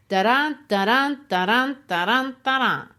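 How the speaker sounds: "da-dun" chanted with the stress on "dun"; "da-dun" as a rising two-beat pulse; iambic beat. Shown as a demonstration. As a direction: beat out "da-dun" five times, weak then strong. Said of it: "you have five of them".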